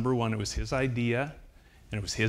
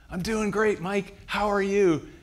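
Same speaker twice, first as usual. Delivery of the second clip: high, loud reply across a room; imitated shouted exchange, pitched well up; calling back; 11 semitones higher, 7 dB louder than usual. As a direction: holler the answer back.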